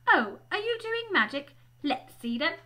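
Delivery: high, loud, eager challenge; bossy girl. Acted. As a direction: pounce on it like a bossy schoolgirl. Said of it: "She had a bossy sort of voice".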